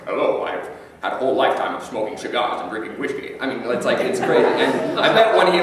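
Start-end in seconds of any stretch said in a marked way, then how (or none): 0.1-3.3 put-on a light old Scot who has smoked cigars all his life; Sean Connery impression. Mocking the star's voice for the audience, lightly Scottish. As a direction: lean into a mild Scottish purr. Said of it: "his voice still sounds like".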